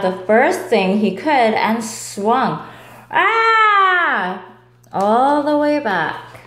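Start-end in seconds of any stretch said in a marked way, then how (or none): 3.1-4.4 high, loud swinging yelp; storybook whoosh yelp; startled yelp. Acted. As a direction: cry out as you swing. Swinging through the air.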